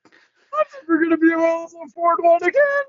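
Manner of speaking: high, whiny fake sob; hammed-up weepy wail; mock crying. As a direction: finish his mock sob for him. Finishes the mock-crying quote.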